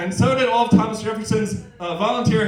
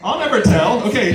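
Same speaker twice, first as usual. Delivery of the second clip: usual speaking voice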